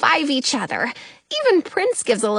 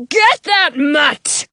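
yelling; 4 semitones higher, 6 dB louder, slower than usual. A narrator's reading. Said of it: "The guard yells".

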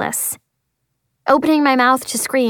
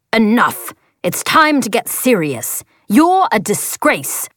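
harsh father's voice; slower than usual. Acted. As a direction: berate him like an angry father. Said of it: "speaks in a human voice that sounds eerily like Jai's dad".

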